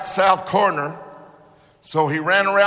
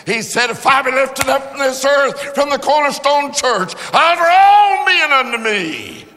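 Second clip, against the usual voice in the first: building louder and higher to the last line; preaching climax; 10 semitones higher, 8 dB louder than usual. Acted. Preacher quoting scripture to the congregation, building preaching climax. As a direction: let the scripture swell to a peak.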